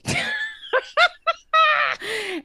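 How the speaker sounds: high, shrieking burst of laughter; helpless squealing laughter; big laugh. Real, not acted. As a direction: crack up in a long high cackle. Cracks up after her own yell.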